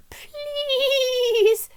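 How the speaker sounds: a high, begging little harp; pleading harp. A narrator's reading. Harp begging Jack at the doorway, high and pleading. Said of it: "said the harp".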